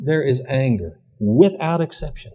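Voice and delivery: ordinary delivery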